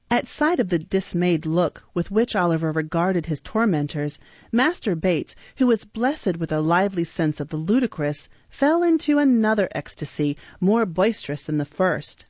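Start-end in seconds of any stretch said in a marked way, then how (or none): none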